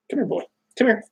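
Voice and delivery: high, soft call to a dog; pet voice. Real, not acted. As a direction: coax the dog over sweetly. Calling dog during video.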